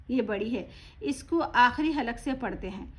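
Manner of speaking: normal talking voice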